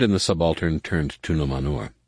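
usual speaking voice

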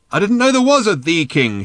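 high, loud yelled reply; shouting. A narrator's reading. Ford answers Arthur on the beast, shouting. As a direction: holler back over a stampede. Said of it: "shouted Ford".

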